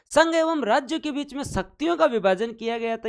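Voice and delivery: high, insistent teacher's restatement; emphatic repeat. Shown as a demonstration. Teacher repeats the point to quiz the class, high and emphatic.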